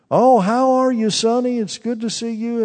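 put-on mild old lady voice; old aunt voice. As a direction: play the frail old aunt greeting her preacher. Old aunt greeting the preacher.

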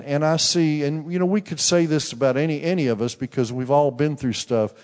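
ordinary talk, nothing marked

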